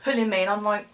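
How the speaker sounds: normal talking voice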